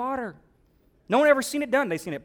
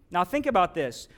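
normal talking voice

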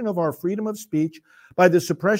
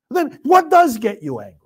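louder, higher demanding question; a pointed, raised challenge; challenging question; 6 semitones higher, 5 dB louder than usual. Real, not acted. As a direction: throw the question at them. Rhetorical challenge to critics.

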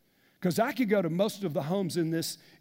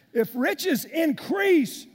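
"If riches increase" shouted slowly, high and loud; loud preaching; 6 semitones higher, 4 dB louder, much slower than usual. Shown as a demonstration. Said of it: "repeats verse, preaching".

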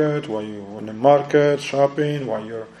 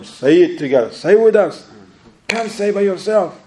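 urging voice; 7 semitones higher, 4 dB louder than usual. Retold or imitated. Mocking a crowd member urging others.

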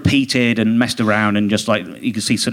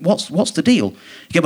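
ordinary talk

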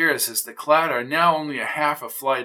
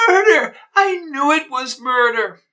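shrieking, much higher and louder "Murder!"; high-pitched scream; 13 semitones higher, 7 dB louder than usual. Acted. Nosy neighbor screams at the sight of the body. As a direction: scream it in vindicated horror. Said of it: "Her high-pitched scream".

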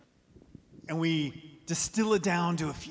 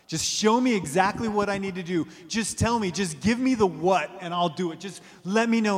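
higher, louder desperate demand; pleading with God; 4 semitones higher, 8 dB louder than usual. Retold or imitated. People demand answers from God, loud and pleading. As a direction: beg for the answer now. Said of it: "when we're saying".

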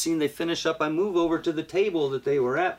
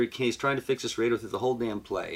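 ordinary talk

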